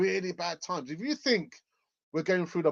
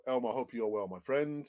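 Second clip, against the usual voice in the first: ordinary delivery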